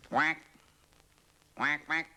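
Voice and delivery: high, loud quacking; duck quack. Acted. Duck heading for the pond, loud high quacks. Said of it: "thought the duck".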